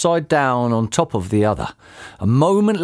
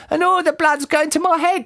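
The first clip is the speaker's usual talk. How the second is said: high-pitched, distressed wife; panicked scream. A narrator's reading. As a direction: wail it in a high voice. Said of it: "screamed Missus Twit".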